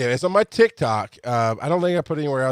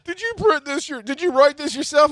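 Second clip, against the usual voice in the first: much higher, wide-eyed disbelieving question; son's disbelief; 12 semitones higher than usual. Retold or imitated. Son asking his dad if the ticket is real, much higher disbelief. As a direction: ask like a kid who can't believe it. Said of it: "he goes".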